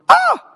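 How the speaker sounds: a high loud mock scream of fright. Preacher mimics frightened people, high loud mock scream. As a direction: yelp like someone startled by a ghost. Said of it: "'Cause people go".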